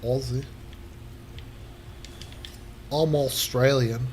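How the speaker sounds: lightly put-on Aussie accent; Australian accent. Quoting a running joke, mock Australian accent. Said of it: "there was an Australian guy".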